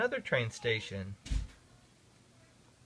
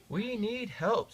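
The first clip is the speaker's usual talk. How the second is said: slow, higher plea for help; 7 semitones higher, slower than usual. A narrator's reading. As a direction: say it slowly, asking for help. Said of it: "said Thomas".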